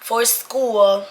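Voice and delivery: ordinary talk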